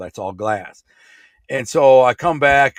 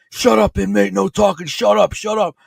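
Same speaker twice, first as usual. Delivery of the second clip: retold guard barking; 9 semitones higher than usual. Guard snapping at the inmate to shut up, mock bark. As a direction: bark orders like a hostile jail guard.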